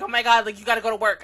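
loud panicked mom; freaking out. Retold or imitated. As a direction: mom panicking that her daughter is late. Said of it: "instantly freaking out".

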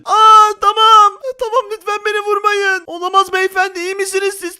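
high-pitched pleading, hammed-up; startled loud cry, then begging; mock panic. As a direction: play scared of being shot. Begs gunman not to shoot.

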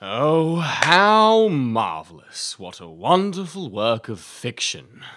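mock the teller with fake awe; hammed-up sarcastic marvel; sarcastic praise.

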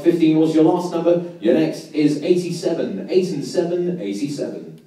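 steady sing-song number calling; bingo caller. Acted.

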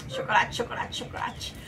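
breathy unvoiced chant; whispering. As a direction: whisper it like a craving.

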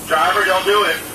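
ordinary talk, nothing marked

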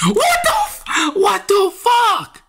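real gamer rage shout; furious shout. Real, not acted. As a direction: scream it at the screen. Shouting after bad game moment.

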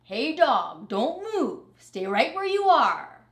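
loud and high, commanding; loud high bossy call; bossy rat call. Acted. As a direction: order Dog to stay put. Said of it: "Rat bossing Dog again".

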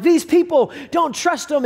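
mock-furious review voice; retold angry warning. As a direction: warn everyone off, heated but acted. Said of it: "went online to post reviews".

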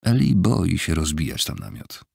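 ordinary delivery, nothing marked

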